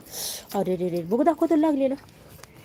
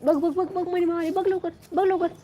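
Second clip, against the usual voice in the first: excited; 6 semitones higher than usual. Real, not acted. Mother excitedly points out cat.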